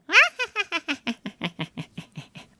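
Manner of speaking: high, twangy demonstration cackle; put-on evil cackle. Shown as a demonstration. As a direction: cackle high, tumbling down in quick bursts. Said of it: "is cackling".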